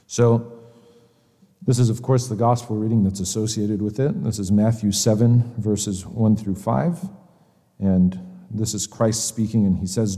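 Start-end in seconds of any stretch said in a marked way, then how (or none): none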